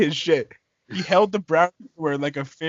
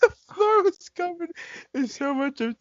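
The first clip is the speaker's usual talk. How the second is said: laughing; 14 semitones higher than usual. Real, not acted. Reader breaking up mid-sentence, laughing. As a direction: try to read on but lose it. Laughing while reading aloud.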